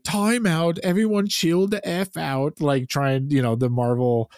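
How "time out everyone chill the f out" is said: mocking quote. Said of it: "he does his whole, like".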